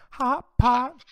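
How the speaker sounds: loud, high segment call; announcing shout. Pretend. Host launching the hot pot segment, excited shout. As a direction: belt it out like a hype announcer.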